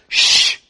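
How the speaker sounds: sharp voiceless shush; hushing shh. Acted. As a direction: shush someone as the world would. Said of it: "See, the world says".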